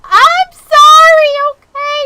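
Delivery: very loud, high, drawn-out mock cry; hammed-up tearful wail; wailed apology. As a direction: bawl the apology melodramatically. Overblown apology to chat.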